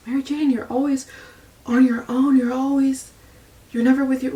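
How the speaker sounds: high, fast, nagging mimicry of critics; imitating relatives who scold her for being alone; mocking judgers. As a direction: parody the people who question her life.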